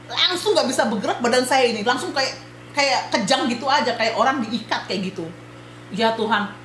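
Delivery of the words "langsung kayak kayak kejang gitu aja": ordinary talk, nothing marked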